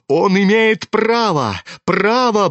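high, drawn-out insistence; muttered insistence. A narrator's reading. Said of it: "бормотал Бурдовский".

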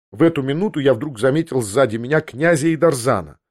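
plain reading voice

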